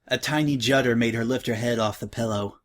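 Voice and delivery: ordinary delivery, nothing marked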